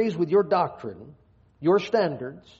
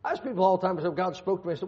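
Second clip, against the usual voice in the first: normal talking voice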